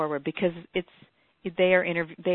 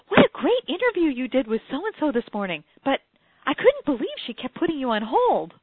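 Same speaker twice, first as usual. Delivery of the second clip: higher, louder gushing report; mimicking office manager; 8 semitones higher, 7 dB louder than usual. Office manager greeting her after the broadcast, higher and louder. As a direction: gush it out brightly. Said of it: "the office manager comes up and says".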